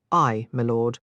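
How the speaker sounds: a humble Scots "Aye, my lord"; Scottish innkeeper. A narrator's reading. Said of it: "in a nearly incomprehensible accent".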